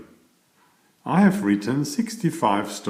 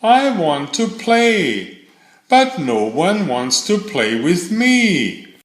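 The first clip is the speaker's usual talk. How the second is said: squirrel's sad plea; 7 semitones higher, 3 dB louder than usual. Acted. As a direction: whine it like a small lonely squirrel.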